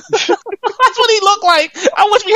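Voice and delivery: high, slow, cracking up through it; laughing. Real, not acted. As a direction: drag it out while laughing hard. Repeats line laughing the second time.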